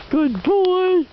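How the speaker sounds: high, bright praise for the dog; happy praise. Real, not acted. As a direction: sing out the praise.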